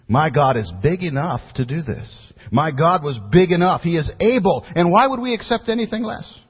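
climactic preaching. Acted. Pastor rallying congregation about God's power, climactic preaching. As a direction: build it to the peak of the sermon.

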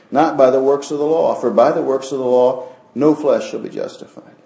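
loud, ringing preacher's cry; preaching at full force. Real, not acted. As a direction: proclaim it to the whole church.

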